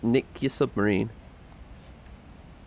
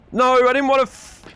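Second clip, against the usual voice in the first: frustrated yell; 10 semitones higher, 5 dB louder than usual. Real, not acted. Mistake in game.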